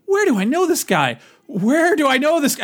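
high, exasperated self-quote; wondering aloud, high and insistent; baffled self-quote. Retold or imitated. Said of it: "I was like, ... it was driving me nuts".

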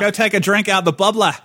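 badly attempted Boston accent turned Australian; botched Boston that lands in Australian. Shown as a demonstration. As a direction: put on a hammed-up Aussie accent. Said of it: "It's gonna come out Australian right now".

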